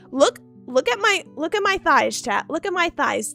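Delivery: ordinary talk, nothing marked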